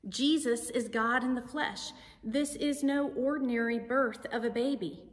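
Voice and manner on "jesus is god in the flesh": ordinary talk, nothing marked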